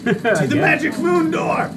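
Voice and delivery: bad acting. In-character hammy quest line.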